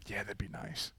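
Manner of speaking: breathy whispered aside; whispered quote. Retold or imitated. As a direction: whisper it dreamily. Podcast guys quoted.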